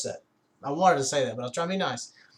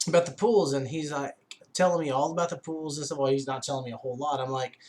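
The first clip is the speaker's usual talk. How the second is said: ordinary delivery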